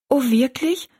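high, questioning delight; glad surprise. A narrator's reading. Rosalie hears the old woman will stay, gladly surprised. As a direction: lift it high in happy surprise.

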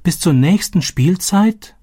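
can't believe what she hears; shocked disbelief. Acted. „Was?. (fassungslos, viel höher und langsam fragend)

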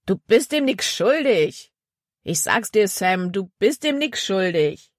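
exasperated bandit, starting high and easing down; insistent bandit. Acted. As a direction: urge a partner in crime to see sense. Voices the other bandit.

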